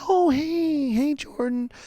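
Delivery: high sweet Oh, hey, hey, Jordan from mom; imitating a mom cooing hello to her son's friend; mom's sweet greeting. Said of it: "She's like".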